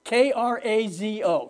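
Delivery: ordinary talk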